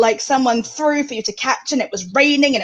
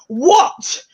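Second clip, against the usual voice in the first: raised South East London bark, T dropped; common London accent; 5 semitones higher than usual. Retold or imitated. Posh child posing as tough to local kids, common London accent. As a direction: imitate a tough London kid, glottal-stopped T. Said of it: "I dropped my T's".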